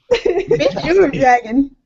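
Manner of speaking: ordinary talk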